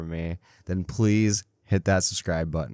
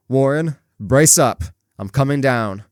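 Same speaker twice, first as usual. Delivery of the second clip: loud, firm shout down into the dark; shouted resolve; 6 semitones higher, 8 dB louder than usual. A narrator's reading. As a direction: call out bravely to rally him. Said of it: "I formed and shouted a resolution".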